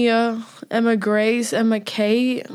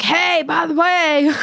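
high, drawn-out sing-song hail; teasing call-out; 7 semitones higher, slower than usual. Retold or imitated. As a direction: imitate hailing someone to tease him. Teasing call-out to a friend's brother.

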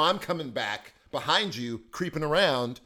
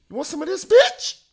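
high, loud rising taunt; squeaky hammed-up threat; mock tough challenge; 12 dB louder than usual.

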